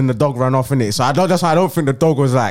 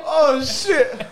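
high breathless laughter through words; laughing hard; 11 semitones higher, much slower than usual. Real, not acted. Retelling the dog bite to friends, laughing hard. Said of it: "I couldn't breathe".

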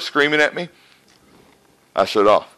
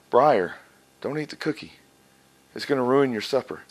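softer, calm parental reasoning; calm explaining; 2 semitones lower, 9 dB quieter than usual. Pretend. Preacher acting a parent calmly convincing Briar, softer and calm. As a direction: gently reason with the child. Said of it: "First, you must convince him".

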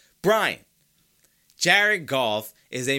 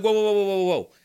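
higher, louder mock-startled string of whoas; 7 semitones higher, 6 dB louder, faster than usual. Host imitating his own shocked reaction to the praise, startled. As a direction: act out being caught off guard, pulling up short. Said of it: "And it was like".

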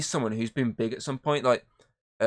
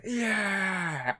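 high, hammed-up cheer of victory; mock heroic whoop; triumphant yell; 8 semitones higher, 3 dB quieter than usual. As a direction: crow it like an action hero out of the water.

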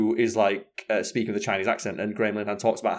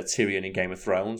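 ordinary talk, nothing marked

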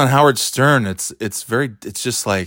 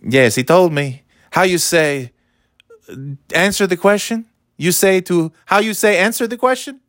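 higher, halting mock Spanish accent; fake Spanish accent; 7 semitones higher than usual. Comic mocking the wife's fake Spanish accent. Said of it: "I can't, I can't do a fake".

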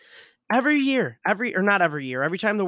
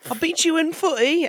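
much higher, hammed-up British gloat; mock British accent; 13 semitones higher, 3 dB louder than usual. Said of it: "With the British accent".

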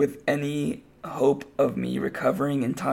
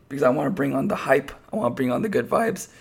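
normal talking voice

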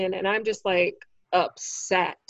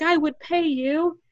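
much higher, slow sweet boss voice; mock reassuring offer; 8 semitones higher, 3 dB louder, slower than usual. Boss sweetening his offer to the comic, high and slow. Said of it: "And then he's like".

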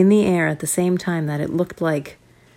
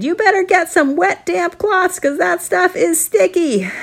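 parent voice; 12 semitones higher, 3 dB louder than usual. Acted. Parents advising kids.

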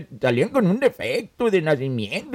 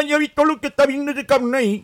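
ordinary talk, nothing marked

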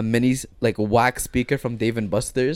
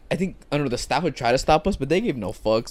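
ordinary talk, nothing marked

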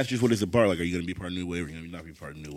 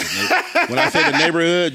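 laughing; 8 semitones higher, 11 dB louder than usual. Real, not acted. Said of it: "laughs heard".

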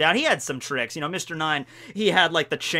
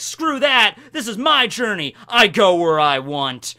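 raised, hammed-up stubborn hero; defiant declaration; 4 semitones higher, 4 dB louder, slower than usual. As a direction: proclaim it in mock defiance. Acts Luffy's defiant refusal.

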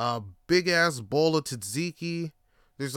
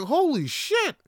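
high-pitched stunned disbelief; acted-out astonishment; astonished; 11 semitones higher, 3 dB louder, slower than usual. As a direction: react amazed, pitch leaping up. Acted astonished reaction to the reveal.

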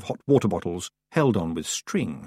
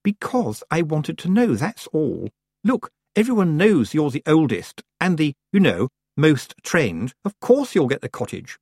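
put-on raised, weary teenage girl; exasperated; 7 semitones higher than usual. A narrator's reading. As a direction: roll your eyes as you say it. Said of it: "Lucy rolled her eyes".

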